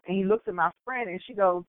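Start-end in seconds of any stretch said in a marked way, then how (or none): none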